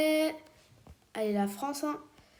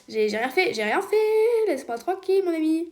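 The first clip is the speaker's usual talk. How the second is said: mock plea; 5 semitones higher, 5 dB louder than usual. Pleads with another player.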